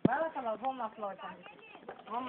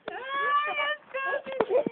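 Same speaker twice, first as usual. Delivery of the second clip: high excited squeal; 13 semitones higher, 15 dB louder, much slower than usual. Real, not acted. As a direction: squeal the reveal. Squealing reveal to baby. (gritinho de alegria pro bebê)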